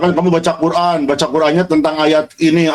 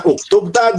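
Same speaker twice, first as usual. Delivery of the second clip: "Uktub, Tad?" high with a rising end; a pupil asking "Uktub, Tad?", pitched up; questioning intonation; 7 semitones higher, slower than usual. Acted. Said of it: "Si anak dari belakang nanya".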